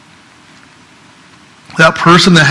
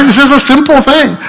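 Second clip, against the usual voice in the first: high, louder insistent exclamation; emphatic; 10 semitones higher, 4 dB louder than usual. Real, not acted. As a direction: drive home how simple it is. Preacher pressing point.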